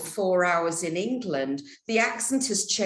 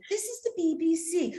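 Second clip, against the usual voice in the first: a light imitation of an old-style BBC newsreader, pitched higher; old BBC announcer; 7 semitones higher, 3 dB quieter than usual. Host recalling how BBC newsreaders used to sound, lightly mimicking the formal announcer. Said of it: "Gone are the days when".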